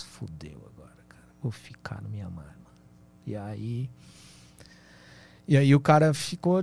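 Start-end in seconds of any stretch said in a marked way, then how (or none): 0.0-2.8 whispered panic to himself; scared whisper. Retold or imitated. Traveler at a jungle checkpoint, panicked whisper. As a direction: hush it out like you're doomed. Eu falei.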